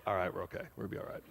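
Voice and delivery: soft whispered relief; quiet relieved reassurance to yourself. Retold or imitated. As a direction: whisper it, letting out the tension. Relief after she takes hand.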